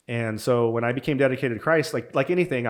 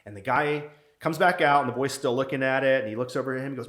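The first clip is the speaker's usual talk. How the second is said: ordinary delivery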